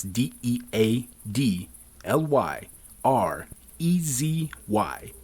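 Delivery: slow, letter-by-letter spelling; spelling it out slowly. Shown as a demonstration. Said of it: "simply type in promo code".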